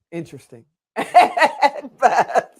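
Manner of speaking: amused, drawn-out words broken by laughter; laughing admission. Real, not acted. Host owning up about those first episodes, laughing.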